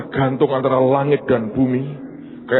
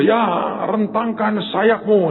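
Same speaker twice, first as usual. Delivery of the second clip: high, slow call to a performer; imitated stage cue; 9 semitones higher, slower than usual. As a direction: give the signal in a drawn-out call. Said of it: "tiba-tiba kan diberi kode".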